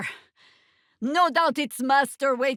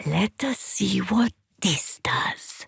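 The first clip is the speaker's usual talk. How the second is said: hushed unvoiced whisper; 5 semitones lower than usual. Sneaking companion whispering to the party. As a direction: breathe the words, no voice.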